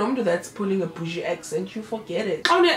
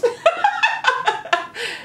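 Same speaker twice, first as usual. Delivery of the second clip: long high-pitched laughter; 13 semitones higher than usual. Real, not acted. Laughing at the retold lie.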